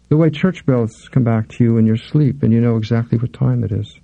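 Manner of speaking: ordinary delivery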